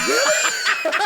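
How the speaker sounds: loud, high-pitched one-word yelp; mock disbelief. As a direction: blurt it out, incredulous. Retold reaction to huge bill offer.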